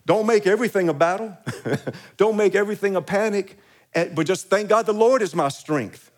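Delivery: climbing preacher's cry; fervent preaching. Acted. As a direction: preach it out with full force. Preaching with rising force.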